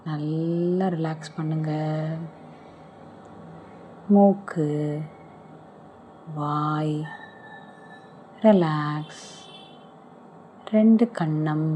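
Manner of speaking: normal talking voice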